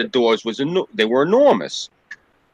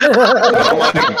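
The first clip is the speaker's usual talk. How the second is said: laughing; 10 semitones higher, 6 dB louder than usual. Real, not acted.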